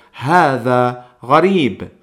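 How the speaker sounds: Huwa gharib slow and careful in Arabic; Arabic phrase. Shown as a demonstration. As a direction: model the Arabic phrase for learners. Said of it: "podemos decir".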